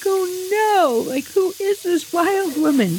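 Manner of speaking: imitating her small shocked self; scared little girl. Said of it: "I'm like".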